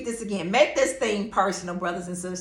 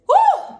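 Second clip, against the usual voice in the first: high loud joyful whoop; excited woo; 6 dB louder than usual. Real, not acted. Shout after telling of tears.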